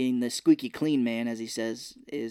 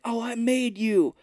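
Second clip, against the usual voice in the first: high, drawn-out mock tirade; hammed-up indignant impression, pitched way up; Robin Williams; 10 semitones higher, 3 dB louder, slower than usual. Ousted host berates the man he made, mock indignant.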